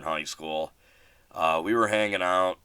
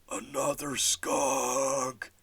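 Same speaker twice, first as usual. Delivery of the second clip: slow, higher, drawn-out dread; 6 semitones higher, much slower than usual. Retold or imitated. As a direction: drag out the bad news slowly. Friend spots yet another skunk.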